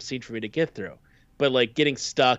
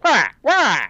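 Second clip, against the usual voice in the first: high, loud panicked wail; panicked yell; 13 semitones higher, 9 dB louder than usual. Retold or imitated. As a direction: yell as if sinking through the floor. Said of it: "He goes".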